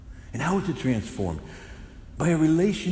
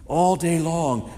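normal talking voice

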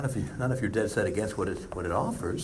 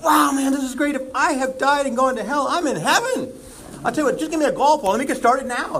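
much higher and louder, gushing; thrilled golfer; 12 semitones higher, 6 dB louder than usual. Pretend. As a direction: shout it like a kid in a candy store. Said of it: "He said".

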